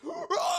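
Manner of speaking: very high, long monster scream; monstrous shriek. Acted. Creature screeching at girl who nudged it, monstrous shriek. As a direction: let out a sustained piercing screech.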